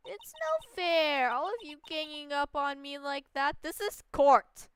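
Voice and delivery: slow, high, put-on pouty whine, then a loud cry; whiny witness. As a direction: sulk loudly at being ganged up on. Voices April May whining.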